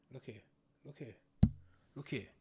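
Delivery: usual speaking voice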